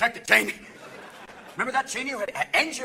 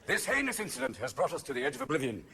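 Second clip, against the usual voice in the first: low, hushed, grave oratory; mock-statesmanlike gravity, low and soft; Tony Blair; 10 semitones lower, 8 dB quieter than usual. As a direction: deliver a solemn statesman's vow softly. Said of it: "But it was Tony who said".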